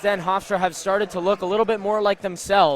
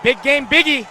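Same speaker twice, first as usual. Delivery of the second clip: higher and louder, thrilled; excited praise; 6 semitones higher, 5 dB louder than usual. Real, not acted. Color analyst hails the shooter after the go-ahead three, excited. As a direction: ride the moment with a big grin. Analyst reacts to big shot.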